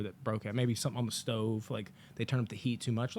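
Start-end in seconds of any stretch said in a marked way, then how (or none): none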